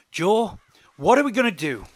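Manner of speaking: urgent question. A narrator's reading. Riley asking Joe for a plan after the row, loud and urgent. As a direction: call out for a decision.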